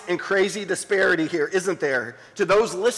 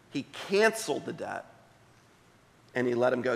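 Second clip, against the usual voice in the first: hushed reading of scripture; 6 semitones lower than usual. Acted. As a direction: read the parable gently to the congregation. Pastor reads the parable aloud.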